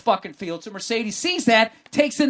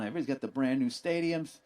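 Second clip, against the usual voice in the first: ordinary delivery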